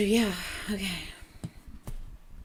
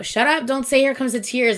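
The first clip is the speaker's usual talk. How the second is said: fighting back tears; 5 semitones higher, 5 dB louder than usual. Real, not acted. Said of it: "I'm literally trying so hard not to cry".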